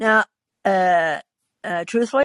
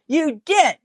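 accusing retort; 7 semitones higher than usual. Acted. Woman bickering with her husband, accusing. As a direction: throw it back accusingly.

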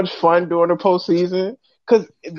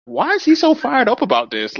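higher, puzzled question; baffled; 8 semitones higher than usual. Retold or imitated. Reacting to the pundit's rant, puzzled. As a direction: wonder aloud why he's so worked up. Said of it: "I was like".

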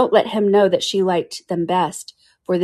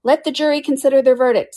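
weary commanding king; 7 semitones higher, 5 dB louder than usual. Acted. King orders the jury again.

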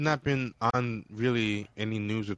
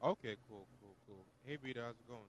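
far from mic; 18 dB quieter than usual. Real, not acted. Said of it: "You sound, like, hella far away".

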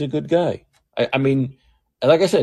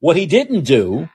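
ordinary delivery

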